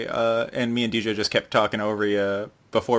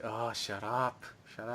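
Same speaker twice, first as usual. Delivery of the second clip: muttered; 13 dB quieter than usual. Retold or imitated. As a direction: grumble it under your breath. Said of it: "It's like".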